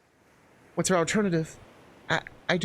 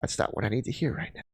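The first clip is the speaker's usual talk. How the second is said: low, soft and wounded; tearful withdrawal; 6 semitones lower, 5 dB quieter than usual. Acted. Mel is let down by partner, quietly hurt. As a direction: pull away, hurt and hushed. In tears after the quarrel.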